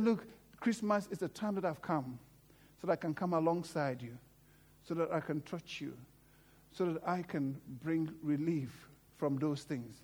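soft, low reassuring voice; tender Jesus. Retold or imitated. Jesus comforts the grieving, soft and low. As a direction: comfort them gently, close in. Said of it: "And he said".

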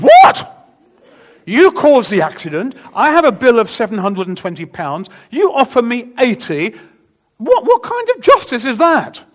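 shouting indignantly at the driver; loud, high, outraged demand for justice; angry outburst. Retold or imitated. Angry confrontation with bus driver.

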